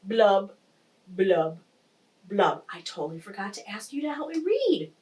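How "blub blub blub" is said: slow, separate blubs stepping down; gloomy fish blubs. Acted. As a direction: glum fish bubbling. Fish's refrain ending.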